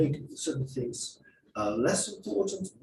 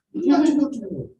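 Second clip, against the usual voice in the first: higher, louder, put-on examiner voice; mock examiner; 7 semitones higher, 7 dB louder, faster than usual. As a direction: play the insistent examiner. Acted examiner pushing the student.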